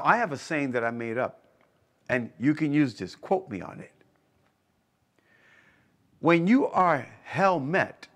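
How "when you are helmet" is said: "helmet" drawn out as two stressed beats, HEL and MET; slow, deliberate slogan, splitting "helmet" into hell-met; punning emphasis. Real, not acted. Coined pun on helmet, hell met.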